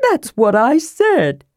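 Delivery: hurt kender voice, drawn out. A narrator's reading. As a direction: insist as if mildly wounded. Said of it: "Tass was hurt".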